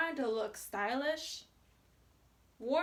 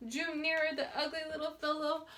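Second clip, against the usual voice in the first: usual speaking voice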